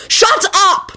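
very high, cutting interruption; yell. A narrator's reading. Boy cutting off the elf from the floor, high yell. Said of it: "Timothy yelled from the floor".

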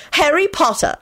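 usual speaking voice

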